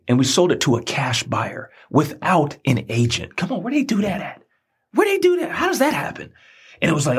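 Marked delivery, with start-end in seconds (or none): 3.4-6.3 amazed disbelief. Real, not acted. Amazed at the seamless house sale.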